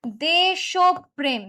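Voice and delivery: দেশপ্রেম slowly, syllable by syllable as desh-o-prem; correct pronunciation. Shown as a demonstration. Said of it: "উচ্চারণ হবে হচ্ছে".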